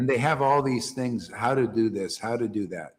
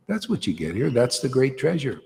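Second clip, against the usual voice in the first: usual speaking voice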